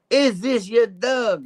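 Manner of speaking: very high, drawn-out inspector impression; Inspector Clouseau. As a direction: repeat it slowly for someone hard of hearing. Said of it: "Inspector Clouseau here".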